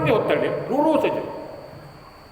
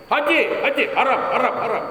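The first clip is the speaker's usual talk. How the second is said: driver calling again; 6 semitones higher than usual. Retold or imitated. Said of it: "Maksudnya pergi Tanah Haram".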